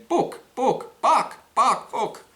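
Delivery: high, loud squawking bark bark; hammed-up chicken squawk. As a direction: cluck it out like a hen. Chicken speaking in joke.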